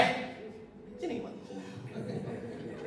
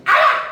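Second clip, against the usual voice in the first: a sudden mock yell, higher and louder; mock shout; 8 dB louder than usual. Scold on the minivan, a sudden mock shout. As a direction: bark it out as you strike. Shout while hitting the car.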